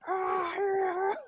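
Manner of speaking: high, loud barbarian war cry; berserker yell. Acted. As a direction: let out a wild battle cry. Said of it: "It's like".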